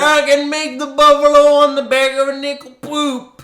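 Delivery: put-on high, loud old coot rant; cackling toothless ranting. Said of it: "my old coot voice that I've assigned to Andrew Borden".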